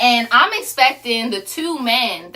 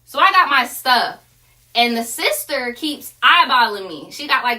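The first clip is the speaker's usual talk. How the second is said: ordinary delivery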